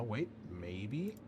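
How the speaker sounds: ordinary delivery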